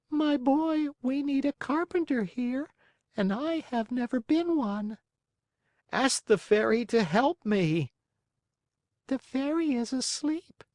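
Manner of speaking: high, unhurried snail voice. Acted. Snail character voice.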